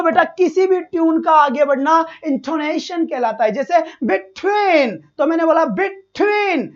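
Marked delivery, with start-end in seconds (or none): 3.8-4.9 "between" with TWEEN stretched out long; drawn-out second syllable. Shown as a demonstration. Teacher showing students intonation, "between" drawn out on its second syllable. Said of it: "twin की sound खींच के बाहर जाती है". 5.6-6.7 "between" said slowly, TWEEN pulled out long; drawn-out second syllable. Shown as a demonstration. Teacher repeating the intonation example, "between" with a stretched TWEEN. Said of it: "twin की sound खींच के बाहर जाती है".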